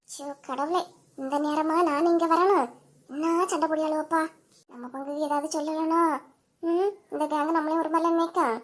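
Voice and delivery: low, soft grumble to herself, out of earshot; quiet sigh of a reluctant neighbour; muttered aside. Acted. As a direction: mutter it under your breath so the others can't hear.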